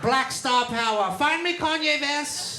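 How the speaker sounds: high, hammed-up villain rallying the troops; mock Nazi orator. Nazi addressing comrades about recruiting, hammed-up oratory.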